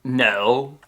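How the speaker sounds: laid-back surfer dude. Acted. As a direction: drawl it like a surfer. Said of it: "I can only reasonably dub with my surfer voice".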